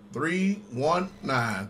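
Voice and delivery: ordinary talk, nothing marked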